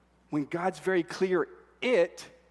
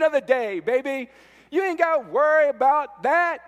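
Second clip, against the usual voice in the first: a lazy drawling preacher, then climbing higher and louder; mock false prophet; 7 semitones higher, 8 dB louder than usual. Preacher mocking false prophets, building to a loud taunt. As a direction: sneer at the smooth-talking prophets.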